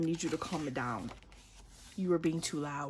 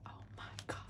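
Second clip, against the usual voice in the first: awed whisper; stunned whispered oh my God; 13 dB quieter, much faster than usual. Real, not acted. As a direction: breathe it out in awe. Awed at photo.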